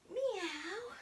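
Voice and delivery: teacher meowing during cat sit-ups. Pretend. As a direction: mew like a little cat. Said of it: "our cat sit-ups".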